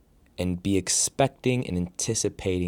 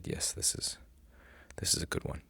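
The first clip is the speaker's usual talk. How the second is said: whispered thought; 8 dB quieter than usual. Retold or imitated. Retelling own thought to self.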